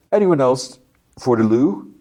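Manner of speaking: high, put-on robber voice; mocking robber. Robber Red asks hostages about the restroom, high and taunting.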